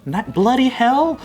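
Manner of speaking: put-on British accent. Admirer mimicking classy-sounding Brits, mock British accent. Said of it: "It all sounds classy to me".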